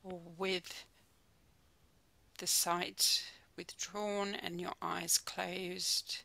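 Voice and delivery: calm, unhurried mindfulness guide with long pauses; soothing body-scan voice, phrase by phrase; guided meditation. Real, not acted. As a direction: ease them gently into stillness, leaving space between phrases.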